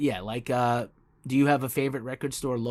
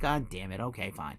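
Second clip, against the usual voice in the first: low grumble to himself, trailing softer; retold reluctant surrender, dropping away; mock resignation; 3 semitones lower, 7 dB quieter than usual. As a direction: give in quietly, as if beaten. Acts out giving in at store.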